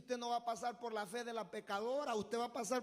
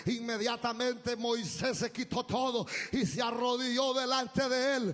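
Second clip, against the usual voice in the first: preaching at full force; 3 semitones higher, 6 dB louder than usual. Acted. Pastor preaching Moses kneeling before God, full force. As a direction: drive the sermon home with rising fervor.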